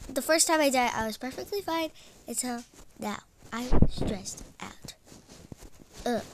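usual speaking voice

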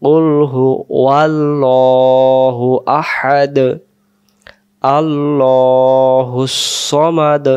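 slow, drawn-out chanted recitation; Quran recitation. Shown as a demonstration. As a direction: intone each word slowly and melodically. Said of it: "এখানে আমরা বলবো".

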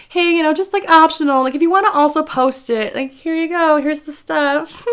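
high starstruck fangirl voice. Retold or imitated. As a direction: squeak it out like a starstruck fan. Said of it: "I was like".

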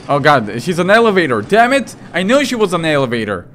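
loud, rising cry of dismay; exasperated outburst. Pretend. Player reacts to the woman being an elevator, exasperated outburst. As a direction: exclaim it loudly, high-pitched and exasperated.

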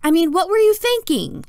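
exasperated mom, loud and high; high-pitched, appalled outburst. A narrator's reading. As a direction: demand it loudly, voice way up. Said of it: "raising her voice".

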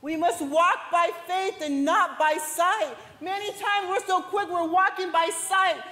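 high, emphatic preacher delivery; sermon in full flow; preaching. Acted. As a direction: press the point home. Preaching to the church.

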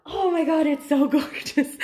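high, giddy gush with a laugh; squealing delight. Real, not acted. Admiring the new plant, squealing with delight. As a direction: gush loudly, laughter breaking in.

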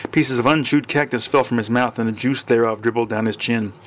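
ordinary delivery, nothing marked

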